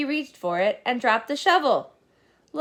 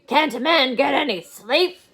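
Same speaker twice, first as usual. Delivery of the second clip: grumpy; 4 semitones higher, 5 dB louder than usual. Acted. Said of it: "he growled".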